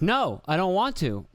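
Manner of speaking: put-on high, slow little-kid refusal; imitating toddler son. Son refusing to say sorry to his sister, high toddler voice. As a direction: pout it out like a stubborn toddler. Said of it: "And he goes".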